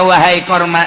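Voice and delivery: ordinary talk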